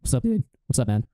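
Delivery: imitating a zoned-out caller; low-key, listless mock greeting; no energy. Retelling how a debate rival called in, listless. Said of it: "no energy, whatever, right?".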